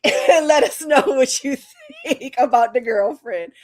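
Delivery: words broken by real laughter; laughing. Real, not acted. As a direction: laugh through the line. Laughing through the sign-off.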